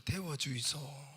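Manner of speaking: slow, drawn-out Gyeongsang plea; choked-up Gyeongsang dialect. Acted. Customer finally clarifying to the owner, choked Gyeongsang dialect. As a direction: stretch it as a choked Gyeongsang request. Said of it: "목이 많은 소리로".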